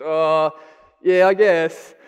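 offhand mock-macho shrug with a laugh. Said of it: "in a macho way too and goes".

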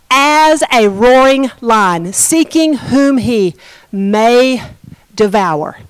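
slow, drawn-out preaching cadence; measured pulpit delivery; slow scripture preaching. Acted. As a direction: lean into each word of the verse.

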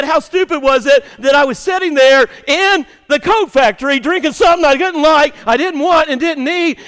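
loud, high, self-mocking rant; shouted retold quote. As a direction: belt out his regret.

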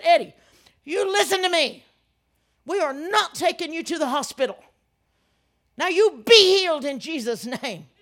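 loud, high, hard-punched commands; forceful healing command. Retold or imitated. Preacher commanding a sick friend to be healed, loud and forceful. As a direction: order the sickness gone at full preaching force.

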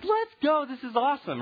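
high, loud team cheer; excited cheer. Retold or imitated. Team reacting to the old man burning his certificate, excited cheer.

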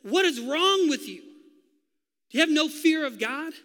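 loud, high, slow rebuke; second thief rebuking the mocker, loud and high. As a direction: scold the other thief. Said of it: "the thief on the other side says".

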